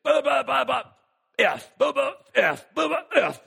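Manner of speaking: mock ranting, blah after blah; angry neighbor cursing. Pastor mimics an angry neighbor cursing from his porch, hammed-up. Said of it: "So here he is".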